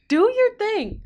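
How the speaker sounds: high-pitched urging. Real, not acted.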